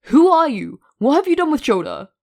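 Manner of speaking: loud, high, furious demand; full of rage. A narrator's reading. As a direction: yell it in rage. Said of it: "voice filled with rage".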